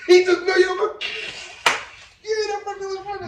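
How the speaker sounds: loud, squealing amusement; laughing outburst. Real, not acted. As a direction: crack up while getting the words out. Cracking up over British actor reveal.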